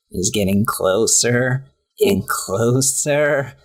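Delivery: slow, drawn-out spooky storytelling; mock-ominous, dragging delivery; ghost-story telling. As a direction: stretch each phrase like a campfire tale. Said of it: "Jason Funderburker is telling this story".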